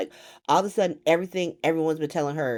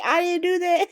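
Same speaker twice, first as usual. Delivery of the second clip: much higher, louder mock denial; 13 semitones higher, 4 dB louder than usual. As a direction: squeak it up like an innocent liar. Quoting Nina's denial, mocked again.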